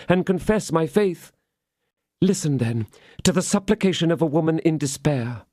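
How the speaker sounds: high, urgent pleading; desperate supplication. Acted. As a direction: beg for your life. Woman in despair begging.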